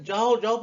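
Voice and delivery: जाओ said loud and emphatic; stress on जाओ. Shown as a demonstration. Teacher contrasting with ordinary speech, stressed जाओ. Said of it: "पे बड़ा stress किया".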